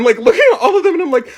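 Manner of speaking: ordinary delivery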